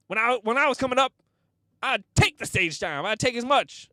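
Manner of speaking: high, loud veteran's grumbling; mock angry rant. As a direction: imitate an angry comic ranting loudly. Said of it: "he was so mad".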